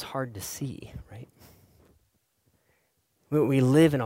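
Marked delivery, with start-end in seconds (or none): 0.0-1.3 hushed confiding whisper to the room. Acted. Pastor confiding to the congregation, whispered. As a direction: drop to a whisper as if sharing a secret. Sudden hush after big preaching.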